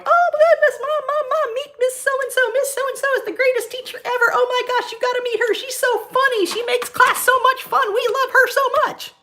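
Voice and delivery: put-on very high-pitched gushing kid voice; excited kids. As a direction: imitate thrilled kids pestering mom to meet the teacher. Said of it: "the kids come up to me".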